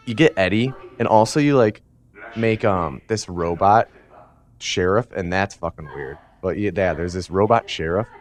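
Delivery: normal talking voice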